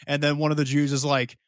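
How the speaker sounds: usual speaking voice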